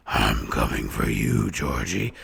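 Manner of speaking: very low, slow monster voice; menacing monster clown. Retold or imitated. Monster clown scaring a kid off, deep and menacing. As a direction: drop it way down and draw it out to scare. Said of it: "the kid would run the hell away".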